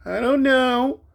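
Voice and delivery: put-on high, drawn-out kid's whine; whiny child. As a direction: play the sulky little boy. Grandson voiced on the phone.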